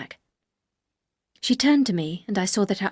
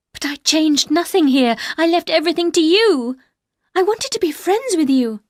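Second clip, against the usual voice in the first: higher and louder, begging; young wife defending herself, high and pleading; earnest pleading; 8 semitones higher, 7 dB louder than usual. Acted. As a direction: plead to be believed. Heroine pleads her case.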